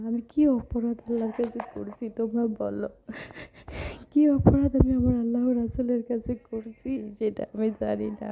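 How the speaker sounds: usual speaking voice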